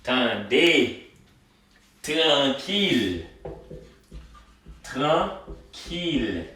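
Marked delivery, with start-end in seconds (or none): 2.0-6.4 "Tranquille" drawn out slowly; slow model word. Shown as a demonstration. Teacher slowly modelling tranquille for students. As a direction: stretch "Tranquille" syllable by syllable. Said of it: "teacher modelling the word".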